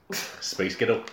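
Scottish accent. Shown as a demonstration. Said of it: "in a Scottish accent".

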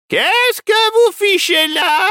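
put-on high, slow, outraged old lady; indignant cry. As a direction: confront the intruders. Old lady catches intruders. (vieille dame outrée)

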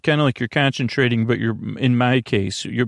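usual speaking voice

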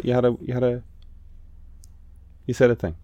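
normal talking voice

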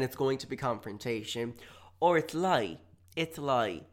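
usual speaking voice

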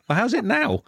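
high, loud mock bafflement; baffled retort. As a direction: play up the confusion, pitched high. Acting out baffled reply to Welsh mates.

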